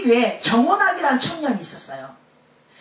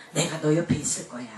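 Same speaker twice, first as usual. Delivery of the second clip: tender reassurance; 13 semitones lower, 8 dB quieter than usual. Acted. Jesus reassuring, much lower, softer.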